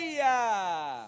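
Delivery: loud, high call to the crowd; rallying shout. Real, not acted. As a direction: shout it to rouse everyone. Leader's call again.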